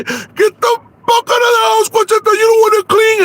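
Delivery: very high, loud crying shout; screaming. Acted. The furious in-law screaming at Conchita to get out. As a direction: shriek her out of the house.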